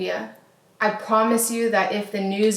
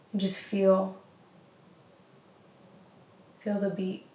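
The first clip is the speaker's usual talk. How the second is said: guided meditation; 5 dB quieter than usual. Acted. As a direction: guide the listener into stillness. Leads a short heart meditation.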